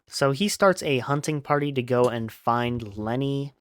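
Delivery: plain reading voice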